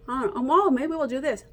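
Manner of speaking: normal talking voice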